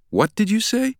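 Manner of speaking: very high-pitched disbelieving question; incredulous. A narrator's reading. Stranger stopped short by Langdon's question, incredulous. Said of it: "looking incredulous".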